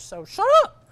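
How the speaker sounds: high, loud shut up; irritated yell at loud neighbors; mock angry shout. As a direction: snap it at the noisy guys, hammed up. Annoyed angler snapping.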